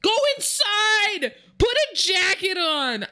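high mock yell of go inside; mock exasperated yelling. To the nude snow photographer, a high exasperated yell. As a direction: scold them like a fed-up parent.

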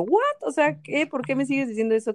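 high, stunned "what?" that settles as it goes; shocked disbelief. Retold or imitated. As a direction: react as if you can't believe your ears. Retelling her shocked reaction.

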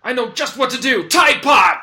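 high, loud panicked yelling; panicked shout. Acted. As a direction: shout in panic, then rally. Bugs thrown on hero.

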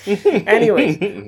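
laughing. Real, not acted. Laughing at own breathlessness.

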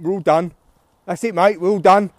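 loud, chirpy-pitched mock workman; lightly mocking workman shout; workmen announcing they're done. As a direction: call it out like a workman finishing a job. Imitates workmen calling out they've finished.

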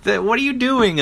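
high indignant demand; mad outburst. Retold or imitated. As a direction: cry it out, scandalised and shrill in pitch. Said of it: "Like, he gets all mad".

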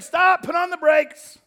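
loud, high, drawn-out command; emphatic slam-on-the-brakes shout; shouted halt. Acted. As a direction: yell to bring everyone to a stop. Acting out halting the crowd.

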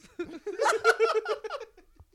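big high burst of laughter; loud laugh. Real, not acted. Laughing loudly as friends hear about Obama's fault. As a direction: crack up loudly at the story. Retold absurd Trump supporter story.

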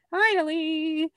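high, loud, slow "Finally", like a long-awaited payoff; drawn-out relieved cry. Retold or imitated. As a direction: stretch it out, relieved and triumphant. Retelling the breakthrough moment.

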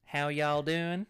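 loud, drawn-out Southern American drawl; Southern American accent. Shown as a demonstration. Asked by co-host to prove his accents, slow Southern American. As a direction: stretch it out slowly in a put-on Southern American accent. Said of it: "do Southern American".

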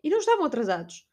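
normal talking voice

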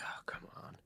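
whispered, exasperated aside; retold hushed complaint to oneself; under-breath grumble. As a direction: grumble it almost without voice. Retold private grumble at shoppers.

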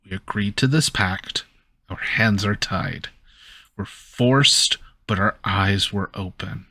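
ordinary delivery

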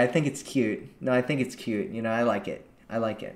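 soft, low and coy; soft flirt. Shown as a demonstration. Woman reassuring the man she caught looking, soft and coy. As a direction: murmur it to him sweetly. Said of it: "making it flirty".

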